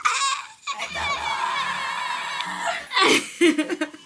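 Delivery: long high squeal of laughter. Real, not acted. Birthday girl delighted with her hair, squealing laugh. Squeals over the new hairdo.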